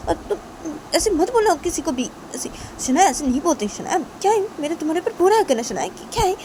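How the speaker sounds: high-pitched, stammering protest; pleading Tisha. Acted. Tisha begging Shanaya not to tell anyone, pleading. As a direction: plead in a high voice, stumbling over words.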